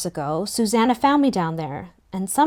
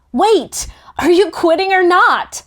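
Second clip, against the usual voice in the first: calling after him, much higher and louder; yelling; 10 semitones higher, 7 dB louder than usual. A narrator's reading. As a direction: shout across the pool. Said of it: "I yelled".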